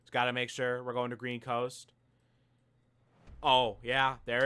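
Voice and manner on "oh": ordinary delivery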